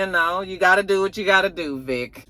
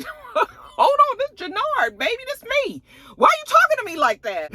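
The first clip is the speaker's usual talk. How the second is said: much higher hurt man's squeak; whiny protest. Retold or imitated. Husband protesting to his wife, much higher hurt voice. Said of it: "He was like".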